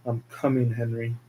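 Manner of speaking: soft, low, threatening promise; quiet killer's threat; menacing hush. Acted. Killer vows revenge on Henry.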